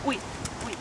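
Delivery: normal talking voice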